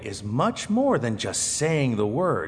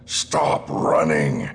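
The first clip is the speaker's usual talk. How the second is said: low, slow bear voice; the bear telling the boy to stop, lower and slower; bear's command; 9 semitones lower, 4 dB louder, much slower than usual. Acted. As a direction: drag the words out deep like a big bear. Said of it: "said the bear".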